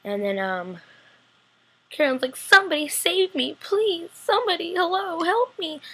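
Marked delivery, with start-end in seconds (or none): 2.4-5.8 high, pleading cry for help; mock trapped-girl plea, high and drawn out; desperate plea. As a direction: beg to be saved, voice pitched way up. Trapped Caroline calls for help.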